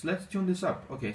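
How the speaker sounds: ordinary delivery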